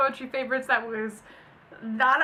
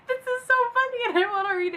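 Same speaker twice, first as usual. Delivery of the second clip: high, giggly and a little louder; laughing; 13 semitones higher, 5 dB louder, faster than usual. Real, not acted. About to share a funny post, laughing. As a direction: say it through giggles.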